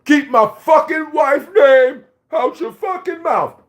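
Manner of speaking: high, slow mock shout; mocking Will's yell. Imitating Will yelling at the Oscars. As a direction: mimic an enraged husband yelling.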